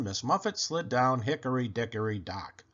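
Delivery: plain reading voice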